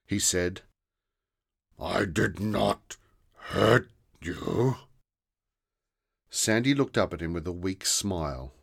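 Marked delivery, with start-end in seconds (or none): 1.8-4.8 slow, softened monster voice; the monster asking in sudden confusion; puzzled monster. Acted.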